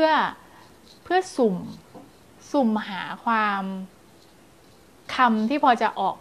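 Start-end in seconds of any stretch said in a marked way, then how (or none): none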